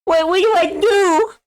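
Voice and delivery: helpless whining in a high cartoon-kid voice; Eric Cartman impression. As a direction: grandpa begging for help in a whiny cartoon voice. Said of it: "I do, like, an Eric Cartman impression".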